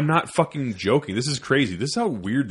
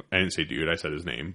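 ordinary talk, nothing marked

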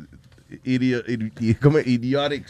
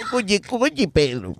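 cartoon sidekick voice; 7 semitones higher, slower than usual. Acts Pablo Mármol line.

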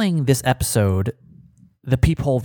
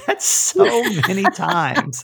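laughing; 6 semitones higher, slower than usual. Real, not acted. Laughing at mom's call count.

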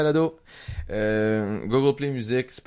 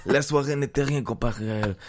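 hammed-up Mexican accent, pitched higher; 5 semitones higher than usual. Host gives in and reads erotic novel in a mock Mexican accent. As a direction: read the romance line with a put-on Mexican accent. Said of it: "Tu devrais lire ça en mexicain".

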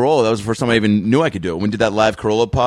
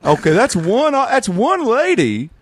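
Doctor Phil impression; 8 semitones higher than usual. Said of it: "if I don't do a Doctor Phil".

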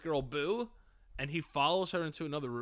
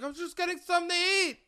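high, fast, dopey excuse; mock innocent high voice; high mocking predator voice; 12 semitones higher, 4 dB louder, faster than usual. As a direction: squeak it out like a caught creep. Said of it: "He's like".

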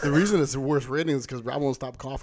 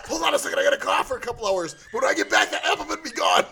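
laughing; 9 semitones higher, 6 dB louder than usual. Retold or imitated. Voicing the guy, laughing.

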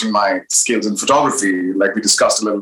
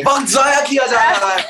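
higher, fast indignant exclamation; 11 semitones higher, much faster than usual. Acted. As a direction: declare it in exaggerated outrage. Comic scene, exaggerated Urdu retort.

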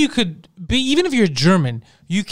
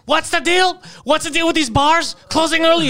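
Hitler rant; 8 semitones higher than usual. Retold or imitated. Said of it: "He could have literally been saying".

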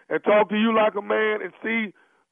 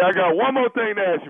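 ordinary talk, nothing marked

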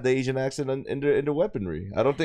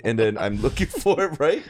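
ordinary talk, nothing marked

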